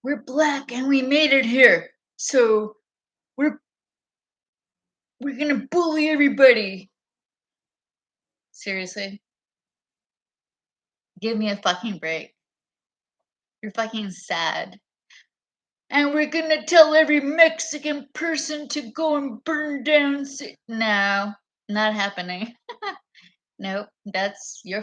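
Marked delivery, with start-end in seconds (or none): 0.0-6.9 higher and louder mocking quote; lightly hammed-up boasting politicians; mock boastful voice. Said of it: "they're like". 15.9-20.6 higher and louder mocking quote; lightly hammed-up boasting politicians; mock boastful voice. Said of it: "continues the mock quote".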